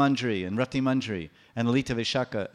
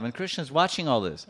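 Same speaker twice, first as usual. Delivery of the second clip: usual speaking voice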